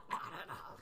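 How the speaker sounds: breathy croak through a laugh; wobbly croak, half laughing; croaky voice. Shown as a demonstration. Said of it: "That's a better croaky one".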